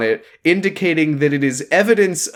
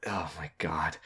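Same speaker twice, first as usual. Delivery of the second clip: breathy whispered disbelief; stunned whisper of disbelief; whispered oh my god; 11 semitones lower, 17 dB quieter than usual. Real, not acted. Disbelief at stupid plot.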